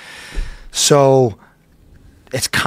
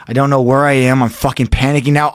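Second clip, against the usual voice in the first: ordinary talk, nothing marked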